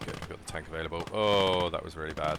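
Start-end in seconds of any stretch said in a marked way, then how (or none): 1.1-2.4 dismayed. Real, not acted. Squad wiped out by flamethrower.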